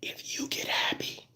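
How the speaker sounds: hushed, fully whispered confiding; secretive whisper. Pretend. Telling viewers hard truths while someone is coming, secretive whisper. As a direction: whisper it like a secret nobody may overhear.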